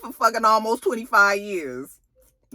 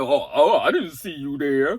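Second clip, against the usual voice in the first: Zeke voice; 9 semitones lower than usual. Retold or imitated. Zeke caught off guard, voiced.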